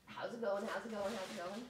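soft greeting. Real, not acted. Greeting Brad softly.

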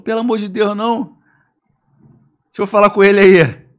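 high, hammed-up pleading; imitated mother in a panic; mock desperate plea. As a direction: beg loudly as the panicked mother. Mother pleading with kidnapper, retold.

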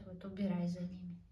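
normal talking voice

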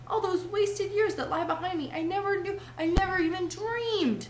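high-pitched entranced wondering; rapt lament. A narrator's reading. Toad laments the years before motor cars, rapt. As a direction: marvel high, stretching the final phrase.

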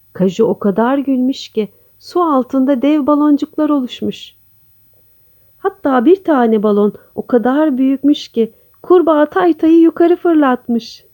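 usual speaking voice

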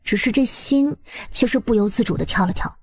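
plain reading voice

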